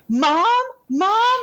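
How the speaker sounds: high imitated call, climbing in pitch; calling for Mom. Retelling a son running home, high calling voice. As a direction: mimic a son searching the house for his mother.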